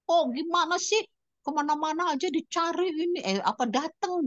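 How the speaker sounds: high-pitched mock scolding; husband's scolding. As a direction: mimic the husband's irritated scolding. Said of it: "Dia bilang gitu kan". (meniru omelan suami dengan nada tinggi)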